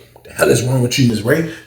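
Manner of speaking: usual speaking voice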